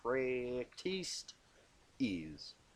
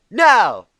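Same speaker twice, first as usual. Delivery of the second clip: much higher, much louder shout; battle cry; 9 semitones higher, 20 dB louder than usual. Acted. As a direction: launch the attack. Applejack's signal to attack.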